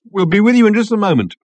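high, loud, breathless call; calling between gasps. A narrator's reading. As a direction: call out while fighting for breath after laughing. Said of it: "called Ford Prefect between gasps".

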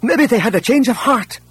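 high, eager voice from the crowd; hopeful exclamation. A narrator's reading. One of the refugees guesses at a change of heart, hopefully. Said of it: "Hopeful exclamations gushed from all sides".

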